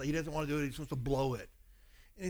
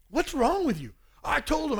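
heated argument; 9 semitones higher, 5 dB louder than usual. Retold or imitated. Wife scolds, he argues back.

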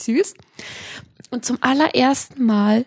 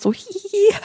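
put-on girlish giggle; 10 semitones higher than usual. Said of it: "hat man mir so an die Schulter gefasst".

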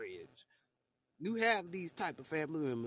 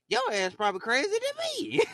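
much higher, louder retort; outraged comeback; 5 dB louder than usual. Retold or imitated. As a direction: fire it back at them, pitched way up. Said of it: "I'd be like".

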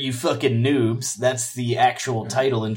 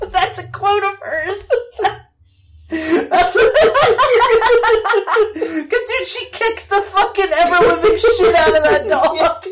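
high, laughing almost to tears; laughing hard; 3 dB louder than usual. Real, not acted. Host cracking up over Jovovich's quote, laughing through the words. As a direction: talk through helpless laughter.

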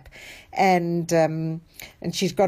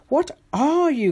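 gasping in amazement, higher; breathless astonished gasp; 8 semitones higher than usual. Acted. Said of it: "gasped Sarah".

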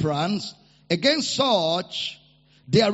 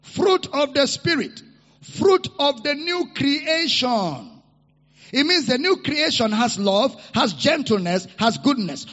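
loud, high, fervent sermon delivery; preaching at full force; 3 semitones higher, 4 dB louder than usual. Real, not acted. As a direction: preach it over the music.

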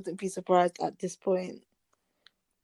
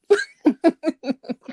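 laughing; 8 semitones higher, 7 dB louder than usual. Real, not acted. Laughs after "scrap that.

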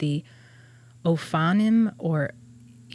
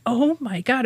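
higher-pitched mock amazement; retold astonishment; 7 semitones higher than usual. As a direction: recall being stunned, pitch jumping up. Retold reaction on first reading it.

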